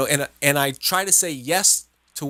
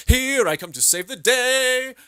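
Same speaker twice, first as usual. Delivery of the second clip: hammed-up hero voice, pitched up and slow; playfully boasts like a cartoon superhero; mock superhero; 10 semitones higher than usual. Husband playing hero man for his wife, hammed-up superhero voice. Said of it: "And I'll go, "Hero man".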